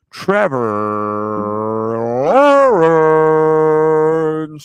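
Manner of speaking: drawn-out sports announcer name call; announcer intro. Pretend. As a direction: stretch the name out big, swelling loud at the end. Announces the starting quarterback by name.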